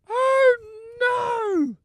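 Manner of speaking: high, loud horrified cry; shocked shriek. Retold or imitated. Shrieking in horror on learning he's John Noakes. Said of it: "In that voice as well".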